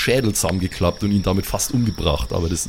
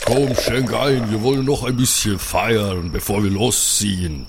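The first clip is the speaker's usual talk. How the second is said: hearty Viking. Acted. GM voicing Björn.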